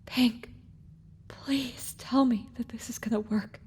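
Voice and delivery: faint, failing whisper; weak whispered plea. A narrator's reading. Dying Sunrise begging Pink for assurance, whispered. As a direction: plead softly with the last of your strength.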